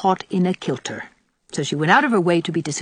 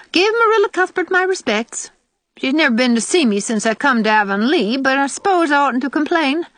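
put-on high, carping old woman; critical old aunt; 8 semitones higher, 4 dB louder than usual. Old aunt criticising both girls as they leave, carping. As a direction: pick them apart in a high, pointed voice.

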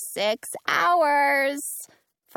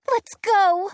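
excited cry; 10 semitones higher, 7 dB louder than usual. A narrator's reading. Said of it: "Dorothy cries".

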